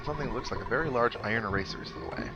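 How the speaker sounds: ordinary delivery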